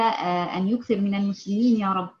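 ordinary talk

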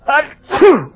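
loud theatrical sneeze; sudden big sneeze. Acted.

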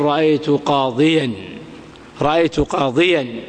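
قاضيا as "qaadiyan", with y and final n; low قاضيا, sounding the ya before "-an"; retained ya. Shown as a demonstration. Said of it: "إلا إذا كان منصوبا". (نطق قاضيا بإثبات الياء والتنوين)

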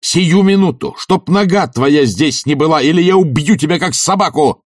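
high, loud, threatening fury; enraged shouting. A narrator's reading. Oblomov threatens to kill Tarantyev, enraged. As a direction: threaten him in a blazing rage. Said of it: "трясясь от ярости".

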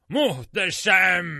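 huge, drawn-out, high exultant bellow; triumphant roar. A narrator's reading. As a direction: roar it slowly, drunk on victory. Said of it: "diye kükredi".

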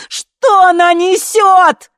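very high, slow yell; loud, drawn-out outraged scream; screamed question. A narrator's reading. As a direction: scream it, dragging each word. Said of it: "заорала Ви".

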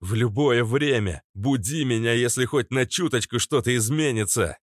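insist, almost shouting; near shout. Acted. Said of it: "Я чуть не кричал".

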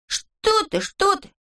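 very high, alarmed protest; high-pitched flustered disclaiming; flustered denial. Acted. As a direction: wave off the charge in a high voice. Neznayka waves off the accusation.

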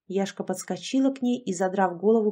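usual speaking voice

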